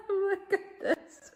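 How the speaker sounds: laughing hard at the cat's fur balls. Real, not acted. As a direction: crack up at the cat. Laughing at the cat.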